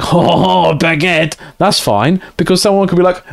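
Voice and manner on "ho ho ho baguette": hammed-up French chortle and accent; joking, over-the-top French voice; caricature French accent. Streamer quoting own silly French accent to chat. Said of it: "just because I was like".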